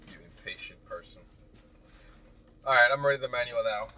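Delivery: normal talking voice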